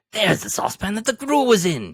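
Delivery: giddy cry; excited cry. A narrator's reading. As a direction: point it out, thrilled. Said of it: "cried Scrooge".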